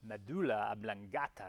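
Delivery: imitating a Jamaican accent on "Medulla oblongata", the vowels shifted; light Jamaican-accented "Medulla oblongata", quoting the movie professor; mock Jamaican accent. Teacher imitating the movie's professor, with a light Jamaican accent. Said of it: "some kind of a Jamaican accent".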